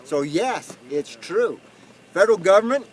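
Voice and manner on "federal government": high, loud rallying demand; getting heated. Real, not acted. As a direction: order the government off, worked up. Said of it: "before I get too hot".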